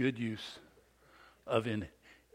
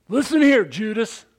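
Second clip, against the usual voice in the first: higher and louder, a furious bark; mad dad; 9 semitones higher, 11 dB louder than usual. Retold or imitated. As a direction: confront the man head-on. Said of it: "He was flippin' mad".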